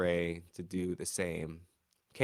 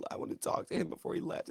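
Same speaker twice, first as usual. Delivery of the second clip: whispered, faster, mocking the whining; 6 semitones higher, 6 dB quieter, faster than usual. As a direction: breathe it out like a sulky complaint. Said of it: "They said".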